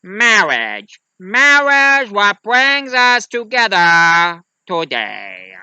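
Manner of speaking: loud, drawn-out pompous priest; priest impression. Priest opening a wedding, loud drawn-out ceremonial voice. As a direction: stretch each word high and loud, falling slowly. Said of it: "a priest who talks like this".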